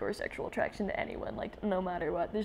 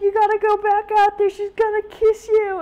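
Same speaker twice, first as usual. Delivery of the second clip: high, loud panicked friend; voiced frantic friend; 12 semitones higher, 10 dB louder than usual. Friend urging Jason back to his date, high and frantic. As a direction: shout the warning to get him out there.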